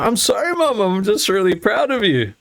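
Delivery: high, sheepish apology; sheepish son. Retold or imitated. Son apologising to mum, high sheepish voice. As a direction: sound sorry but cheeky.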